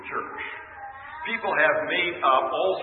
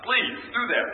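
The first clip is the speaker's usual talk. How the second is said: begging; 8 semitones higher than usual. Retold or imitated. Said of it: "We want to God to do".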